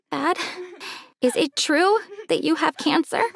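gulping back tears. Acted. Daughter asks her dad about his cancer, near tears. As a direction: ask it while holding back tears. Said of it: "I gulped back my tears".

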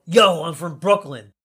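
put-on loud, drawn-out Brooklyn accent. New York guest answering the introduction, loud Brooklyn accent. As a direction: ham up a Brooklyn shout. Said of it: "And I go".

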